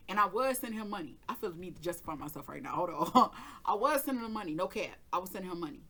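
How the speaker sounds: ordinary delivery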